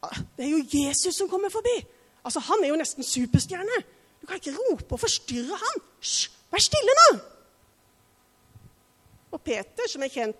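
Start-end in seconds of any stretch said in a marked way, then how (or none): 0.1-5.9 higher, fast, fussy scolding; dismissive crowd. Retold or imitated. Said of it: "sa de kanskje". 6.0-7.1 much higher, much louder shushing yell; hushing shout. Retold or imitated. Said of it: "sa de kanskje".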